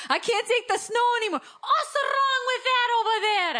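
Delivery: very high, loud mock wail; exaggerated exasperated whine, slowing down at the end; hammed-up complaining. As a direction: wail it like a fed-up mom. Goes on acting the snow complaint.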